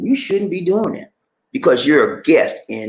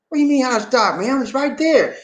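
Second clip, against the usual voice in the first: retold incredulous protest; 8 semitones higher than usual. Retells himself disputing with the clerk.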